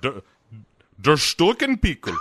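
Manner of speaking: high hammy German, stuck pickle jar; mock German accent. Podcaster riffing on German pickle jar joke, mock German. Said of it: "pretty close to, to Sprockets".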